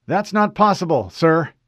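Fred reporting to an unknown officer, formal. Acted. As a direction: state rank crisply.

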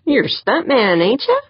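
tipsy teasing, pitch rising high. A narrator's reading. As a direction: playfully needle him with a high lilt. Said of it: "Pancho teased".

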